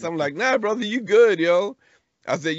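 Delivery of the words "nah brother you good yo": higher, louder reassuring retelling; retold pep talk to a brother; emphatic reassurance. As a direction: buck him up, voice lifted. Retelling reassuring a crying dad.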